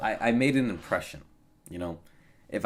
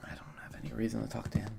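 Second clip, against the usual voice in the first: quick voiceless aside, much softer; fast whisper; 9 dB quieter, faster than usual. Real, not acted. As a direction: hiss it out quickly under the breath. Brushing off a caller.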